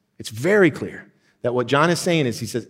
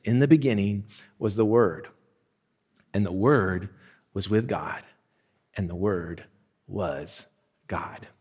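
each phrase set apart, fading softer toward God; 4 semitones lower, 5 dB quieter than usual. Acted. Scripture read with pauses.

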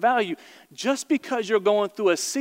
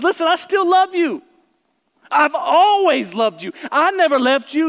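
high, loud cry of reassurance; God's loving reply; 9 semitones higher, 5 dB louder than usual. Acted. God answering the sinner, loud and high.

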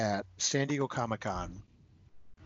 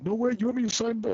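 higher and fast, imitating his astonishment; surprised disbelief; 12 semitones higher, faster than usual. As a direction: act amazed at being asked. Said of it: "And he's like".

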